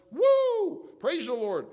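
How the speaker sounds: high, loud whoop, then praise the Lord; a loud, delighted Woo! then praise; joyful shout of praise. Acted. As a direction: burst out rejoicing like at a revival. Preacher acting out joyful praise.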